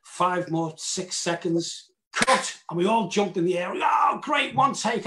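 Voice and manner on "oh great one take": high, loud celebratory shout; jubilant cheer. Retold or imitated. Cast cheering a successful first take, jubilant. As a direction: whoop with the whole crew.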